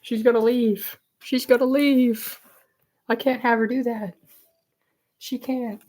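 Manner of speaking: high whine about a pet leaving; mock distress. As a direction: lament it like a playful heartbreak. Laments the cat leaving.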